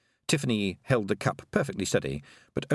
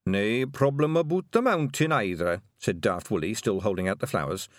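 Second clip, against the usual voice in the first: put-on Scottish accent; 6 semitones higher than usual. Scots pixie voice.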